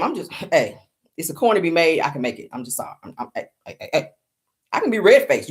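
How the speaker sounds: ordinary delivery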